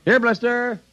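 very high sing-out call; calling a dog. Acted. Father looks for the family puppy, calling.

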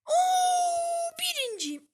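high, loud cry of joy; victory cry. Real, not acted. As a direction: scream it out in triumph. Winning the match.

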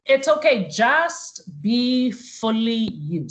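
ordinary talk

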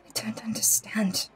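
hushed whisper. Real, not acted. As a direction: whisper it, unsettled. Shaken after hearing voice.

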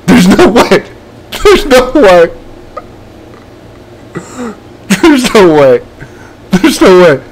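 loud, high cries of disbelief; shocked disbelief. Real, not acted. Gamer reacting to finally beating the challenge, disbelieving shouts. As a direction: shout it, unable to believe the win.

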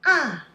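"a" starting high, dropping sharply; fourth tone. Shown as a demonstration. Teacher shows learners the Chinese tones, falling tone. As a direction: drop "a" hard from the top, short and loud. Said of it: "Let's see what they are".

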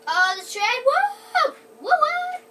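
high loud whooping cheer; excited cheering. Real, not acted. Cheering at the train.

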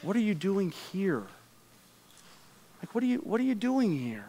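soft, quiet and tender, far from scolding; God gently asking Elijah why he's there; gently caring God. Acted. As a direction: ask it the way a loving father would. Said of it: "here's how I think he was saying it".

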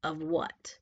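low, dismissive witch; indifferent witch. A narrator's reading. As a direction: brush her off in a low voice.

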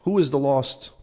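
ordinary delivery

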